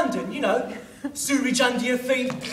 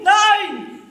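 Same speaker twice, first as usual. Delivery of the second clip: higher, louder alarmed disbelief; shocked cry; 8 semitones higher, 4 dB louder, much slower than usual. Acted. Baldric told of nine spies, shocked cry. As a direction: yelp it in horror.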